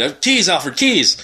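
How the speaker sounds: rushing, bark for the keys; hurried demand. Retold or imitated. Bruce hurrying Alfred for the car keys, mock urgent demand. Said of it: "in some sort of hurry".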